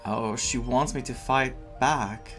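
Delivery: normal talking voice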